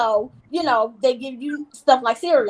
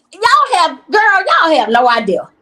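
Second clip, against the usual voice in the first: emphatic exclaim; 2 semitones higher, 8 dB louder than usual. Real, not acted. Emphatic appeal to viewers.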